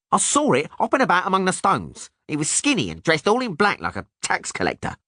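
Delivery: high, indignant squeak; insist, offended; indignant gnome. A narrator's reading. Gnome voiced.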